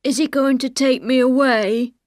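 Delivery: anxious, simple-minded pleading in character; Ben, frightened. Acted. Ben fears being taken away, frightened and pleading.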